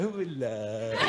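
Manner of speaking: low, drawn-out mock hello; lightly put-on voice greeting the camera; camera-struck audience member. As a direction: play someone caught on camera. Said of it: "don't look at the camera and go".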